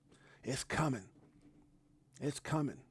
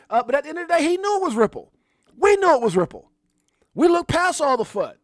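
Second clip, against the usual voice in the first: loud, high victory yelling; rally the believers, shouting; triumphant shout to the chat; hyped-up shout; 11 semitones higher, 10 dB louder than usual. Real, not acted. Hype rant about Ripple.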